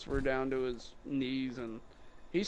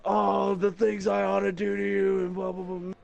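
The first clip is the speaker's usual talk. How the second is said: mock angry customer; 5 semitones higher than usual. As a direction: lightly send up the ranting customer. Retelling the angry customer's rant.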